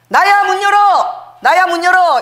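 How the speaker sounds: high, loud, slow yell at the door; shouting to be let in. Retold or imitated. Locked-out husband yelling at his wife to open up. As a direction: bang on the door with your voice.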